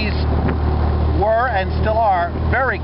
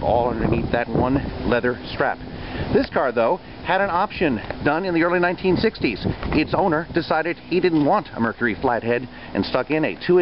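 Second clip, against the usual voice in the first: documentary voiceover; 7 semitones lower than usual. A narrator's reading. Voiceover narration of car history.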